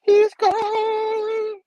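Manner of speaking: very high, loud drawn-out cry; hammed-up falsetto howl; mock wail. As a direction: wail it in exaggerated dismay. Acting a cry of loss.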